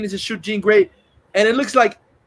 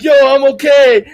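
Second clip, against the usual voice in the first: loud, higher shout to the crowd; shouted greeting; 5 semitones higher, 10 dB louder than usual. Retold or imitated. Punisher in the costume hailing the crowd, loud shout. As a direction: call out big from the window. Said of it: "acts like he's Spider-Man".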